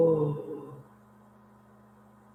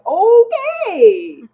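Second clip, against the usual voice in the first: much higher, louder laughing "Okay"; startled coworker; 12 dB louder than usual. Retold or imitated. Imitating a coworker backing off from her death glare, high squeaky laugh. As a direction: squeak it out like you just got glared at. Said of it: "and he was like".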